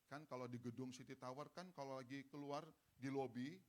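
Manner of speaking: ordinary talk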